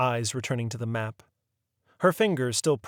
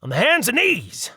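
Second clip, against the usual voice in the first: louder, higher backcountry captain grumbling; an old skipper telling a grudge; folksy riverboat captain; 13 semitones higher, 9 dB louder than usual. A narrator's reading. Captain repeats vow louder.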